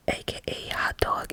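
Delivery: ordinary talk, nothing marked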